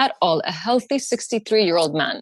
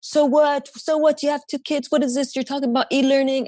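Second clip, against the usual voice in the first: mocking brother; 6 semitones higher, faster than usual.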